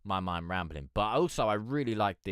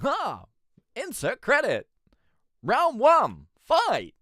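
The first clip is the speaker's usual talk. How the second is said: much higher and louder, hammed-up announcer yell; arcade announcer; 10 dB louder, slower than usual. Streamer mocking the announcer he hated, high and loud. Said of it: "He'd be like".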